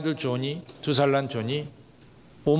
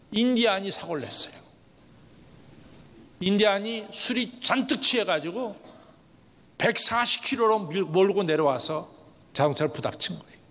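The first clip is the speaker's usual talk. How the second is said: ordinary delivery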